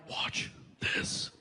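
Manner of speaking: unvoiced conspiratorial aside; sly whisper. Dad confiding before fooling the principal, whisper. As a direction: whisper it like a trick is coming. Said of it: "I was like".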